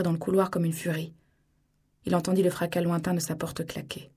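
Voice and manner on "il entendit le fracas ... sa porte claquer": plain reading voice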